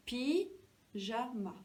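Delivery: "Pyjama" slow, split into syllables. Shown as a demonstration. Teacher showing the first syllable, syllable by syllable. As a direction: stretch and separate each syllable of "Pyjama". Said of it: "Essayez de redire pyjama".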